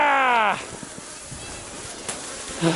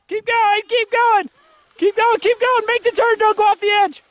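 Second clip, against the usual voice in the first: higher, eager urging; urgent cheering; 8 semitones higher than usual. Real, not acted. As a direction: push them on as they ride. Urging a sledder down the hill.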